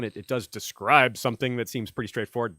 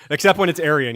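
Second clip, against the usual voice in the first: normal talking voice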